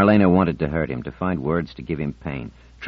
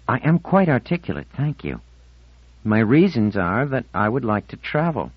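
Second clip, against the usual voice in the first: put-on higher-pitched girl, primly defending herself; indignant Marlena; 7 semitones higher than usual. Marlena answers the charge she can't express herself, primly indignant. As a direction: voice a girl standing on her dignity.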